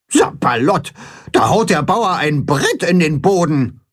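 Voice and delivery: aufgebrachte Gans, leicht höher gesprochen; wetternd. A narrator's reading. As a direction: schimpfend wie eine empörte Gans. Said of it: "wettert Grimm". (wettert empört)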